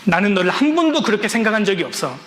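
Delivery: father crying, insisting to his son; tearful father. Retold or imitated. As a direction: repeat it with rising emotion, as the father cries. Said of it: "더 울면서 그렇게 말씀하셨대요".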